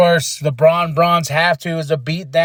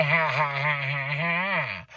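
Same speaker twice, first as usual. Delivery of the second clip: soft spaced-out "ha ha" laugh; imitated laugh, fading softer; mock laugh; 3 semitones lower, 9 dB quieter than usual. Imitating Seth after his taunt, mock laughing.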